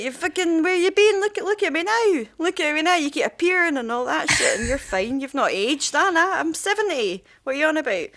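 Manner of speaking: high-pitched mock rant; exasperated son. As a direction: berate your father in a hammed-up retelling. Aged son scolding his dad.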